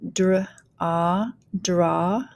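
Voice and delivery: "draw" built from dr and ah; teacher blending "draw" slowly; phonics blending. Shown as a demonstration. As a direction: sound out dr, ah, then join as "draw". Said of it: "go ahead and start blending".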